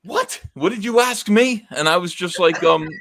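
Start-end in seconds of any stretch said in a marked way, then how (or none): 0.0-1.7 high, disbelieving outrage; mock-outraged old artist; incredulous anger. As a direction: spit it back as if the question is an insult. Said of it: "furious with me".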